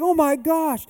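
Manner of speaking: high, loud, awestruck exclamation; acted-out joyful disbelief; retold amazement. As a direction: imitate a man overwhelmed with astonishment. Retold amazement at healed wrist.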